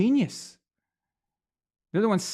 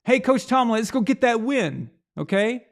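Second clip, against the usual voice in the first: louder, higher cheering call to the coach; cheering fan call; 6 semitones higher, 4 dB louder than usual. Retold or imitated. Fan calls out to passing coach Tomlin, loud cheering shout. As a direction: yell it out like an eager fan in a walkway. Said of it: "And the fan just goes".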